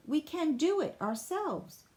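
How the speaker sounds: usual speaking voice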